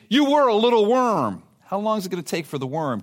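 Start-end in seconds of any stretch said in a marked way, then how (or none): 0.1-1.4 mock teasing. God teasing Israel as a worm.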